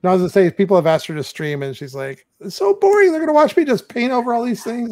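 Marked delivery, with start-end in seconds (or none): 2.4-4.9 high-pitched mock complaint; imitating wife. Husband quoting wife refusing to stream, mock whiny. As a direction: whine it in a put-on higher voice. Husband voicing wife's reluctance.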